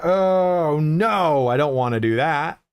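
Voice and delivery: drawn-out high groan easing down; mock dismay. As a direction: protest in exaggerated disgust, starting high and loud. Acted recoil from the accent.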